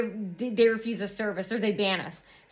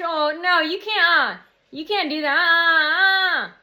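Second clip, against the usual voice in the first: higher, drawn-out mock wail; mock wailing protest; 5 semitones higher, 5 dB louder, slower than usual. Imagining the firm regretting it, mock wailing. Mocking their wail when business fails.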